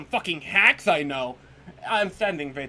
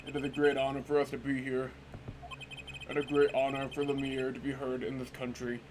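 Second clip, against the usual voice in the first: deep formal manager voice; 4 semitones lower, 5 dB quieter than usual. Acted. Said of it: "I am making this voice for me".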